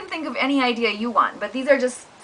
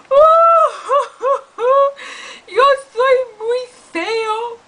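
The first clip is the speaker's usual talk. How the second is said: much higher, slow fake crying; sobbing kitten; 13 semitones higher, 7 dB louder, much slower than usual. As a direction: whimper like a sad kitten. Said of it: "we would sound like this".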